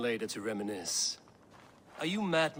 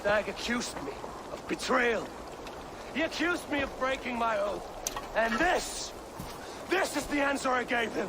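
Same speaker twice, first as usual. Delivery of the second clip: high, loud, ringing address to the crowd; loud public declaration; 11 semitones higher, 4 dB louder than usual. Acted. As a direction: proclaim it to the whole village. Addressing the crowd after duel.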